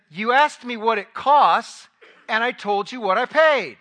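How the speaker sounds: loud, indignant snap, slow on the first words; a husband's loud, prickly comeback; irritated retort. Retold or imitated. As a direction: fire it back, annoyed and defensive. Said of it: "that was kind of the attitude that I had".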